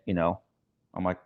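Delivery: ordinary talk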